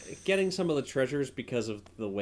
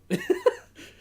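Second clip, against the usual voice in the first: a sudden shriek of laughter, pitched way up; high shrieking laugh; 4 dB louder than usual. Real, not acted. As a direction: let out a loud high squeal of laughter. Burst of laughter on stream.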